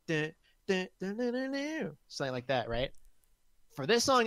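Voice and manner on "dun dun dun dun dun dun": sung-out "dun" riff climbing high; imitated big-finish riff. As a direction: mimic a rock ending, rising in pitch. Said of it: "it's like".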